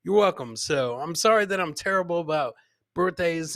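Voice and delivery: ordinary talk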